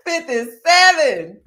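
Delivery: slow, loud, through laughter; laughing repeat. Real, not acted. Repeating the viewer's punchline, laughing loudly.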